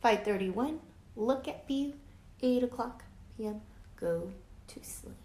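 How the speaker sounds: ordinary delivery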